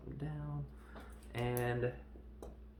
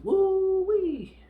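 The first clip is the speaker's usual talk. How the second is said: loud high hammed-up whoop; whooped "woo-wee"; 13 dB louder than usual. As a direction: let out a piercing mock cheer. Mock cheer at the love scene.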